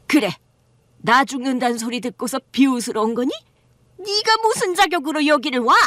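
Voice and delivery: contemptuous mother-in-law snapping at her son's wife; hostile mother-in-law. Acted. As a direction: sneer at the daughter-in-law. Mother-in-law voiced in story.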